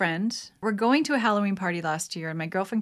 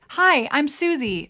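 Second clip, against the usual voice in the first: bright, slow meet-and-greet voice; mock introduction; 7 semitones higher, 6 dB louder, slower than usual. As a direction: play the stranger saying hello. Mock self-introduction to a stranger.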